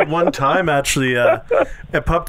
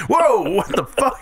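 drawn-out, higher mock astonishment; acted-out shock, pitched up; startled; 7 semitones higher than usual. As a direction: stretch the whoa like a stunned bystander. Voices startled passers-by.

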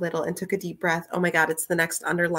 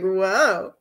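mock awe; 3 dB louder than usual. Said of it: "They're like".

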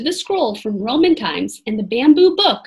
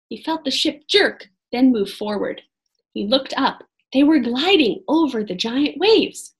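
ordinary delivery

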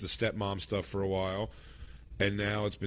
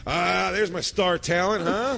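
smarmy boss; 7 semitones higher, 3 dB louder, slower than usual. Acted. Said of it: "overplays his hand with me".

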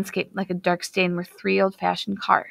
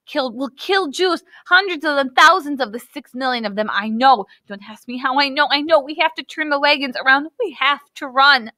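desperate pleading; 6 semitones higher than usual. Acted. Hannah pleading with her family to flee, desperate and high. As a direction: beg them to turn back before it is too late. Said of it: "she cried out desperately".

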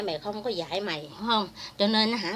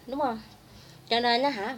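normal talking voice

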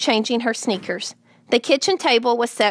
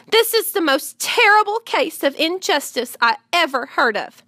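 put-on higher-pitched girl's protest; indignant child; 5 semitones higher than usual. A narrator's reading. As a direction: declare it with earnest outrage. Fern argues passionately with her father.

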